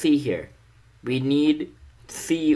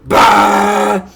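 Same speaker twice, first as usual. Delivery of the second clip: loud, high sung blast; one-note mock solo; 9 semitones higher, 13 dB louder than usual. Streamer's joke one-note solo, a loud high blast. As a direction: belt out a single loud note. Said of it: "I'm going to sing you a song in B minor".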